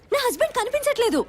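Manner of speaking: high, desperate shout. Acted. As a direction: cry it out at the top of your pitch. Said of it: "ఎందుకు అరుస్తున్నావు madam?".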